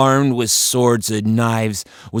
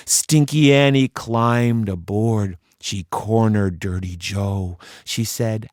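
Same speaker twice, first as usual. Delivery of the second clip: ordinary delivery, nothing marked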